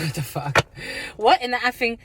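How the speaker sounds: ordinary talk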